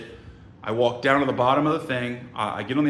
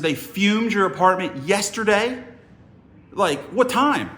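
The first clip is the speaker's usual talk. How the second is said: higher-pitched, acted-out disbelief; incredulous questioning; 8 semitones higher, 3 dB louder than usual. Visitor questioning woman about yesterday's fuming, incredulous. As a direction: replay the alarmed double-check. Alarmed retelling of his reaction.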